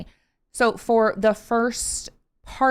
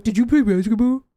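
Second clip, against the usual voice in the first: loud, goofy dimwit parody; mocking imitation; 6 dB louder than usual. Every person who sees her tall dad asking, mocking goofy voice. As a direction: garble it loudly like a silly nosy stranger. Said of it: "That's what every person sounds like".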